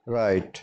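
राइट said "rite", w not sounded; silent w. Shown as a demonstration. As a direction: say राइट with no w at the start. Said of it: "इसलिए इसको हम pronounce करेंगे". (राइट बिना डब्ल्यू की आवाज़ के)

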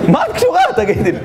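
very high-pitched indignant question; incredulous mock protest. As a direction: squeal it in comic disbelief. Mock protest at woman in advert. (תדהמה מוגזמת בקול דק)

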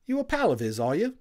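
a WWI orderly waking up chatty; friendly Cockney Tommy, loud and high; cheery Cockney medic. Acted. Tommy to Little Bear.

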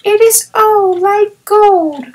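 put-on small boy marveling at golden hair; awed young child; little boy Peony. A narrator's reading. Peony admires snow child.

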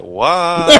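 high, loud disbelieving what; mock baffled outburst. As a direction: squawk it in confusion. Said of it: "you're like".

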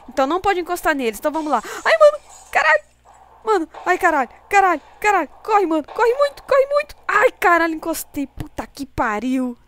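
fast, high panicked yelling; being chased. Real, not acted. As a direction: scream and curse while fleeing. Fleeing monsters again.

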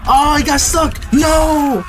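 high-pitched startled squealing; jump-scare panic. Real, not acted. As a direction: yelp frantic commands way up high.

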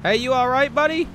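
calling out loud and high; man down shout. Real, not acted. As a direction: shout down the hill to him. Calling to fallen player.

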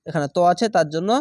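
ordinary talk

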